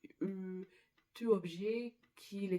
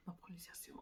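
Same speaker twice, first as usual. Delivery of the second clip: breathy, much softer murmur to herself; whispered aside; 2 semitones lower, 16 dB quieter, faster than usual. Real, not acted. Muttering to herself about her pronunciation, whispered. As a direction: hush it as a private rueful remark.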